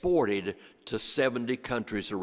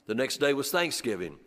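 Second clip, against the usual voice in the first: ordinary talk, nothing marked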